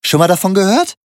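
high, loud mocking question; mock him for having no humour; 1 sneering question. A narrator's reading. Thug mocks the silent narrator. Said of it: "fragte er".

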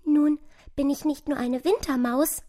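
high, happy little mouse voice; wispernde Maus. A narrator's reading. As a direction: speak small and high, like a relieved mouse. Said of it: "wisperte die kleine Maus". (hohes, zartes Mäusestimmchen)

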